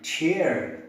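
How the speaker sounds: chair in an American accent, with the r sounded; American chair ending in a clear r. Shown as a demonstration. Teacher showing American chair with a sounded r. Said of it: "but in American English it is".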